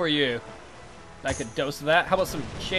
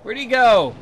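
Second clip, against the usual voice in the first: urgent shout; 6 semitones higher, 10 dB louder than usual. Real, not acted. Losing the ship in battle.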